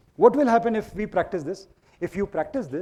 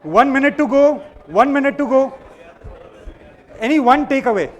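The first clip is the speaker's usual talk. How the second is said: raised, high voice over the noise; calling out to crowd; 6 semitones higher, 7 dB louder than usual. Real, not acted. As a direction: shout instructions across the room.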